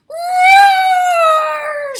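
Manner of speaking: very high, very loud shouted roar; huge roar. Acted. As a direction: let out a giant angry roar. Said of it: "She roars a great big red roar".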